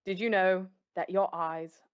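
clumsy attempt at Obi-Wan's British-style accent; half-successful Obi-Wan accent pick-up line; badly faked Obi-Wan accent. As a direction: try a smooth accent and miss it. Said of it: "I can't do his accent well".

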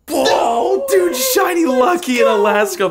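yelling with sudden joy; excited shout. Real, not acted. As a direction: shout it, thrilled by the find. Shiny Pokemon found on trip.

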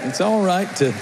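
fervent pulpit preaching. Acted. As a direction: preach it out to the congregation. Preaching to congregation.

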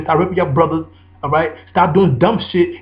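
ordinary talk

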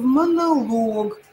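Монолог drawn out, stress on ЛОГ; slow correct stress. Shown as a demonstration. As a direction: stress the last syllable ЛОГ in Монолог, slowly. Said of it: "не вызывает у вас". (моноЛОГ, медленно)

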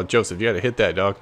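normal talking voice

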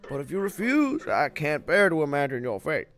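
put-on theatrical old mentor, grave warning; put-on kindly guardian voice; worried Elder Kettle. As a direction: counsel the boys like a storybook elder.

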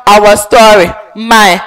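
ordinary talk, nothing marked

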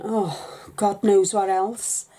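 usual speaking voice

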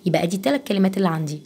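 usual speaking voice

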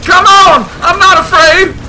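loud, high defiant yell; high-pitched nervous bluster; shouted bravado. Real, not acted. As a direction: yell it out to psych yourself up. Bravado in horror game.

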